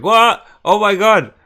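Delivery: loud, high-pitched shriek of disbelief; high, shouted reaction to a huge surprise; shocked. Retold or imitated. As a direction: cry out in utter shock. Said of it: "I was so shocked. I was like".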